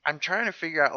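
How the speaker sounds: normal talking voice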